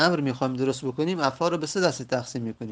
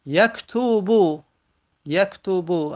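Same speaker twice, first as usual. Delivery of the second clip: یکتوبو drawn out slowly, pitched higher and louder; slow raised model reading; 4 semitones higher, 4 dB louder, slower than usual. Shown as a demonstration. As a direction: announce یکتوبو slowly to the class. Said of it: "حرف سوم دمه داره". (یکتوبو را آهسته و با صدای بلندتر بخوان)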